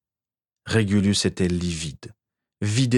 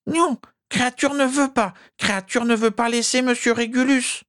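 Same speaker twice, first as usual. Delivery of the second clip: high, desperate elf refusal. Acted. Elf refusing to leave master, high elf voice. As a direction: refuse like a stubborn loyal elf.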